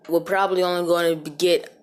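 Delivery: ordinary talk, nothing marked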